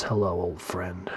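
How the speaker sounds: a hushed, brooding hello; low Batman greeting. Retold or imitated. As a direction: murmur it low and slow like Batman. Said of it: "and he goes".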